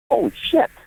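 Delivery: reliving the shock, voice jumping up; stunned disbelief. Retold or imitated. Father stunned after daughter calls him out, high disbelief.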